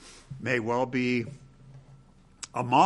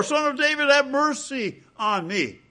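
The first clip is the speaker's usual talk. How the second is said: crying out even more; 11 semitones higher than usual. Retold or imitated. Blind man, rebuked by the crowd, cries higher and harder. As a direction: call out harder, pitch way up. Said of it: "But he cried all the more".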